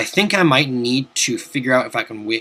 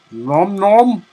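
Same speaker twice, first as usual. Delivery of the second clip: playful nom; 6 semitones higher than usual. Pretend. Eating the Christmas log.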